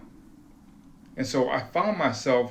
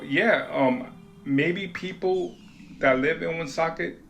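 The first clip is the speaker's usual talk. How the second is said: ordinary delivery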